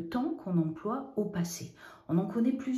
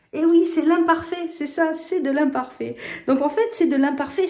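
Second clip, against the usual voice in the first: excited reveal; 8 semitones higher, 8 dB louder than usual. Real, not acted. Teacher revealing answer enthusiastically.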